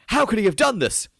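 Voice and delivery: high, theatrical outcry of disbelief; mock shock. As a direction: imitate a scandalized crowd. Mimicking the world's shocked reaction.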